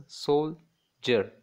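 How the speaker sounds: "soldier" as sol, longer pause, jer; syllable by syllable. Shown as a demonstration. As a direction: slowly split "soldier" into two beats. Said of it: "that is two syllables".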